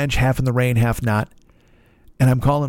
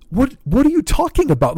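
baffled outburst; 12 semitones higher than usual. Retold or imitated. Baffled at the clerk.

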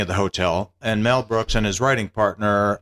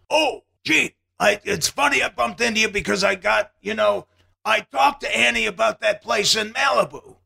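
much higher, sheepish Mel Brooks voice; 11 semitones higher than usual. Retold or imitated. Mel Brooks bumping into Eugene outside, much higher. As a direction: break the news awkwardly. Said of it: "And he said".

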